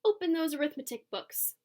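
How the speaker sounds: strict teacher ordering the class, curt; stern witch teacher. A narrator's reading. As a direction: bark out the order. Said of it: "snapped Miss Swamp".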